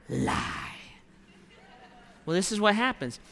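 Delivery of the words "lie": whispered verdict. Real, not acted. Preacher labels the belief.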